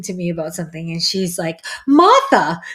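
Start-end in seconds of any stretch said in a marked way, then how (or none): 1.9-2.6 loud, high Long Island exclamation; Long Island accent. Retold or imitated. Host imitating her friend Kathy, exaggerated Long Island accent. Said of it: "The way she said it".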